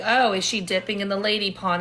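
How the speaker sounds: usual speaking voice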